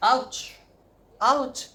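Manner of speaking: loud high ouch; sharp high ouch cries; pain yelp. Real, not acted. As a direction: yelp as the scissors pinch.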